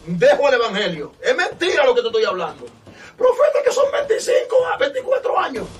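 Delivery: high-pitched voice of a disbelieving inmate; heated acted protest; agitated protest. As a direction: plead the objection loudly, pitch climbing. Acted prisoner protesting his sentence.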